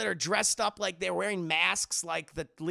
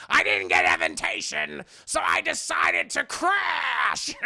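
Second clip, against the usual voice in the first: loud, raspy shouted villain voice; hammed-up raspy villain announcement; Danny DeVito's Penguin; 11 semitones lower, 8 dB louder, slower than usual. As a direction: shout it raspy, stretching the last word. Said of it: "Danny DeVito's penguin's like".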